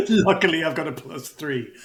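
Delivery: high, loud start breaking out of laughter; laughing mock echo. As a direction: tease the player, laughing into the words. GM laughingly echoes player's failed roll.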